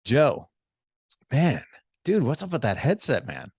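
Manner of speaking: punchy, impressed bro greeting; admiring. Shown as a demonstration. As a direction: sound like you think it's cool. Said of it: "made me feel like you liked it".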